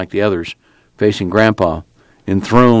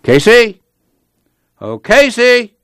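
high, loud call across the yard; 12 semitones higher, 4 dB louder, slower than usual. A narrator's reading. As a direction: call his name from afar.

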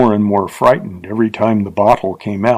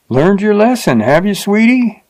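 higher, mocking sweetie; taunting the fallen girl; jeering; 9 semitones higher than usual. A narrator's reading. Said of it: "he jeered at her".

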